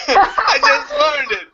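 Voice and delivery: real laughter breaking through the words; sudden burst of real laughter; laughing. Real, not acted. Laughing at his own admission.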